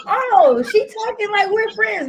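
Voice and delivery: high, loud mock voice of a coworker; mock the coworker's assumption; imitated coworker. Mocking how a coworker misreads her casual talk, high voice. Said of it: "for you to think".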